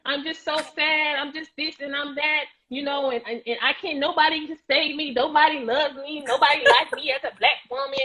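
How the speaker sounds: hammed-up wallowing victim, 'nobody loves me'; victim mode. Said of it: "in victim mode".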